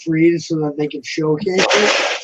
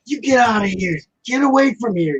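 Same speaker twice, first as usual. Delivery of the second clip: retold dismissal; 6 semitones higher than usual. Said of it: "oh, I go".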